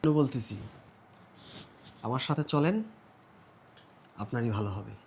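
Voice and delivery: soft, low persuasion; hushed urging. Acted. Buyer asking her to come along, hushed urging.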